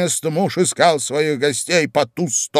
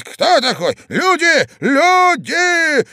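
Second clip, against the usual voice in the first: high, loud alarm calls; old man shouting; 5 dB louder, slower than usual. A narrator's reading. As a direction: shout for people to come, voice climbing. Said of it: "закричал старик". (крик старика: на помощь)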